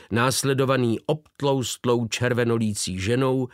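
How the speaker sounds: plain reading voice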